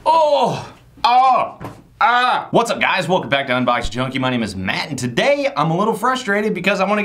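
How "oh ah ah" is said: loud high yelps of disgust; disgusted shouts. Real, not acted. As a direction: yelp out in revulsion.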